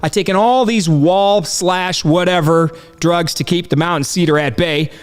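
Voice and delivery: ordinary delivery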